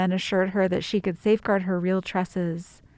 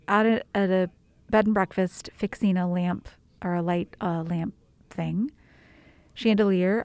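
ordinary talk, nothing marked

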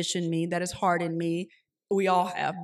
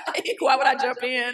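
louder, bursting into real laughter mid-sentence; laughing; 5 semitones higher, 7 dB louder than usual. Real, not acted. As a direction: laugh through the words at your own joke. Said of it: "that's so funny".

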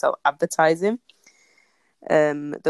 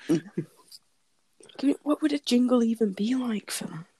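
hushed, higher whisper; whispered question; 5 semitones higher, slower than usual. Real, not acted. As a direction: wonder about it in a whisper. Wondering aloud about a jingle.

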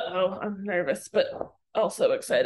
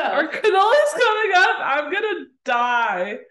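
laughing; 8 semitones higher, 6 dB louder, slower than usual. Real, not acted. Laughing excitement over cannolis.

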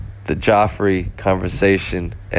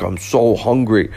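put-on German accent; 2 semitones higher than usual. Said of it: "he had this thick German accent".

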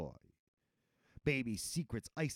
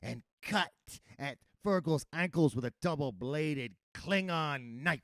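ordinary delivery

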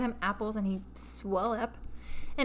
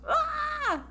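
mock scream. Con man fakes an attack.

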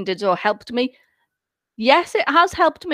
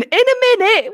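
1 mock old lady; 11 semitones higher, 5 dB louder than usual. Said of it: "I'm going".

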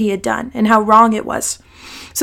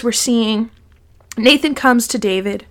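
usual speaking voice

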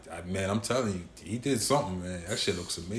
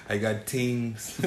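put-on Toronto accent; much slower than usual. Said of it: "The Toronto accent".

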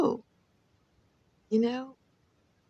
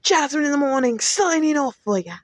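higher, brighter DJ sign-off; radio host sign-off; 6 semitones higher than usual. Acted. Podcast host signing off to listeners, upbeat announcer voice. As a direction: sell the show name like a morning DJ. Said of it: "So, once again".

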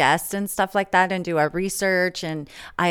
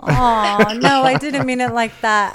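protesting; 4 semitones higher, 5 dB louder than usual. Real, not acted. Apologising after teasing.

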